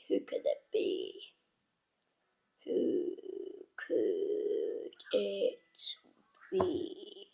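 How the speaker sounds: low hushed whisper, drawn out; suspense whisper. Real, not acted. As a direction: keep them hanging in a whisper. Suspense whisper before reveal.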